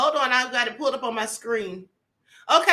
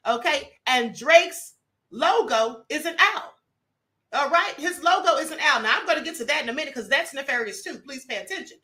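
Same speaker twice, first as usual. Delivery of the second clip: ordinary delivery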